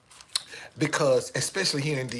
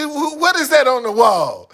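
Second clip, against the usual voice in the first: high, loud startled wife; mock shocked wife; wife asking; 11 semitones higher, 15 dB louder than usual. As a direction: point at the wall, alarmed. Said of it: "I'm looking, she".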